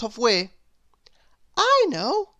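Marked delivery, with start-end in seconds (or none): 1.6-2.3 high, bright-eyed know-it-all cry; eager little girl voice. A narrator's reading. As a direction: pitch it way up, keen to answer. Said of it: "said little Miss Brainy".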